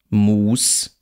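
"Mus" with a long close oo; long tense vowel. Shown as a demonstration. Lecturer reads minimal pairs, long tense vowel. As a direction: hold the u in "Mus".

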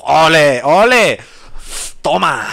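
loud, rising ole cheer; loud triumphant ole ole. Real, not acted. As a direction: celebrate the hit like at a bullfight. Cheering own hit in game.

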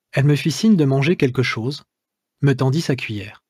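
plain reading voice

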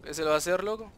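usual speaking voice

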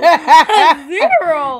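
slow, drawn-out laughing. Real, not acted. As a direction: stretch it out through laughter.

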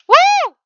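very high, loud whoop; short high-pitched cry; startled whoop. Real, not acted. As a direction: let out a sudden piercing woo. Startled whoop during play.

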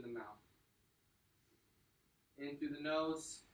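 calm breathing guide. Acted. Coach guiding the cool-down breathing, soft and calm. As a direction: ease them into the stretch.

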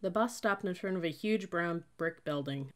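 usual speaking voice

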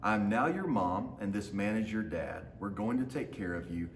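usual speaking voice

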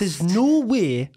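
very drawn-out Geordie model line; slow Geordie. Shown as a demonstration. Coaching the accent phrase by phrase, very slow Geordie. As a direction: project it slowly in broad Geordie. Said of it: "and slow".

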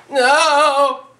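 loud and high, horrified; shocked shout. Acted. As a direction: scream your disbelief. Shocked at father reveal.

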